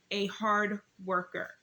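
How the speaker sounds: "hard worker" slowed and weighted; emphasis on HARD WORKER. Real, not acted. Reading Proverbs aloud to viewers, deliberate emphasis on "hard worker". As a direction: lean slowly on each word of "hard worker". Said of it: "give a little more emphasis on a hard worker".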